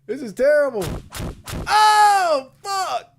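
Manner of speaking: high, loud horrified shout; panicking gamer. Real, not acted. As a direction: howl it out in dismay. Player being hurt.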